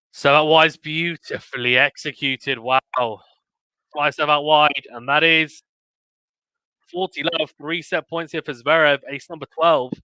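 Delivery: excited live sports call; play-by-play. Real, not acted. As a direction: call the rally as it happens.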